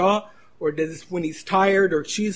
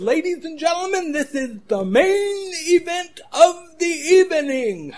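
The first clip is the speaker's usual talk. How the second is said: Bruce Buffer announcer; 12 semitones higher, 3 dB louder than usual. Retold or imitated. Preacher mimicking the announcer introducing the main event. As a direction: stretch every syllable like a ring announcer. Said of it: "the way of drawing those syllables out".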